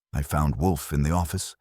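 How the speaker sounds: ordinary delivery, nothing marked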